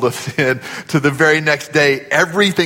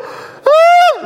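high laugh; 5 dB louder than usual. Real, not acted. Pastor laughs after imitation.

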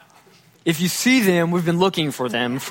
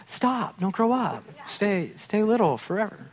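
higher, softer, pleading like a fond parent; wistful plea; 4 semitones higher, 6 dB quieter than usual. Retold or imitated. A parent softly begging a growing child to stay small. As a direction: sigh it out, begging time to stop.

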